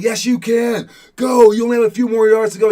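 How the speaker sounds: high, loud and fast push to keep going; coach yelling encouragement. Retold or imitated. Coach urging the crawling player through the last yards, loud and fast. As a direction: shout him past his limit, rushing the words.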